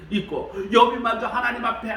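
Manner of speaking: normal talking voice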